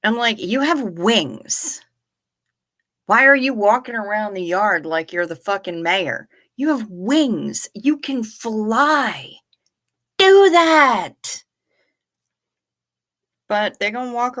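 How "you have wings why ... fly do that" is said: drawn-out talking-to that bursts into a yell; mock scolding the crows. Talking to the crows in the yard, exasperated then shouting.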